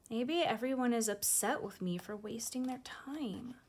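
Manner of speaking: plain reading voice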